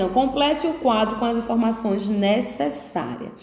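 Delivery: ordinary talk, nothing marked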